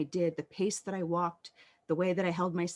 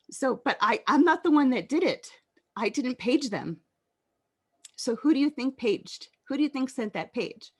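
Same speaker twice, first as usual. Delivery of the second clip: real choked-up, higher voice; getting emotional, pushing the words out louder and higher; 6 semitones higher, 4 dB louder than usual. Real, not acted. Survivor realizing someone else sent the page, emotional. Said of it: "I'm gonna get emotional".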